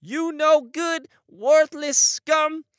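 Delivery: shrieking high furious voice; seething shrieking spirit. Acted. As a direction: scream it in towering rage. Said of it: "[name] was particularly triggered".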